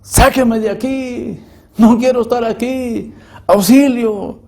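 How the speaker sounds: high, drawn-out cries for help; imitated screaming. Damned souls in a sea of fire begging out, screaming. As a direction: wail it high, imitating tormented people begging. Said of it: "gente que está en un mar de lumbre gritando".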